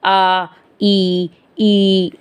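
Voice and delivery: आ, ई, ई held level, mouth only; oral vowels. Shown as a demonstration. Teacher showing mouth-only vowels, आ, ई, ई held without nasality. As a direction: sustain आ, ई, ई with no nasal sound. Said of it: "सिर्फ मुख का प्रयोग हुआ".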